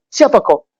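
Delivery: high-pitched startled challenge. Acted. Boy surprised by a man near him, startled. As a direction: ask it as if caught off guard. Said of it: "Kaget dia".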